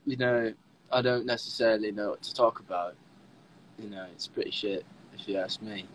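low, understated British accent. Shown as a demonstration. Streamer doing a British accent on request, low and soft. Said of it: "Do your best British accent".

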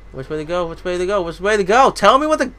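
rising frustrated shout; building to a yell. Real, not acted. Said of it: "I'm yelling now".

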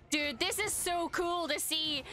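ordinary delivery

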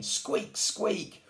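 tiny high squeaky attempts; little squeaks. A narrator's reading. Little Lion trying again to roar, high squeaking. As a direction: squeak each word out. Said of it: "tried Little Lion again".

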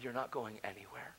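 low, very quiet divine reply; voice of God. Retold or imitated. God answering a crying man, low quiet voice. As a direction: say it softly and low, with calm authority. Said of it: "God spoke to me".

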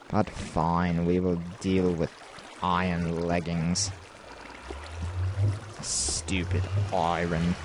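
low, soft grumble; grudging resignation. Real, not acted. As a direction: give in sulkily, dropping pitch and volume. Gives in to iron, grudgingly.

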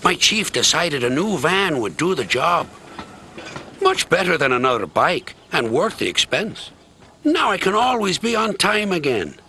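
proudly showing off his new van; lightly voiced happy postman. A narrator's reading. Narrator voices happy Tom.

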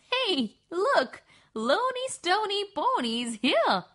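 put-on sing-song jeer at the girl's name; mocking classmate. A narrator's reading. Classmates mocking the new girl.

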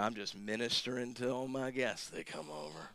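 hushed, low imitation of a self-satisfied host; soft mock-holy murmur; mock pious host. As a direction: mimic someone quietly pleased with their ministering. Mocking a smug host with bathroom verse.

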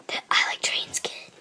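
soft unvoiced whisper. Real, not acted. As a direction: whisper it to yourself. Whispered aside.

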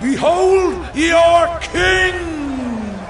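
high, very drawn-out call; grand proclamation. Acted. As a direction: proclaim it slowly to the crowd. Pilate proclaiming to the crowd.

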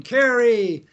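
"Kerry!" shouted high and loud; yelling a name. Retold or imitated. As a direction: call your name across the canyon. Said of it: "you yell your name".

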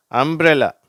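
அம்ப்ரெல்லா said high and loud with mbr blended; model word umbrella. Shown as a demonstration. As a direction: pronounce அம்ப்ரெல்லா carefully. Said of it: "அப்ப எப்படி வருது".

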